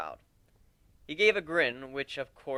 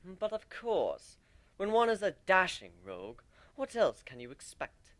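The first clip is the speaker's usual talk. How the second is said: lightly posh, mock-dashing rogue; a touch of hammed-up refinement; playfully showing off to the crowd; refined tone; 5 semitones higher than usual. A narrator's reading. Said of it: "speak in a refined tone".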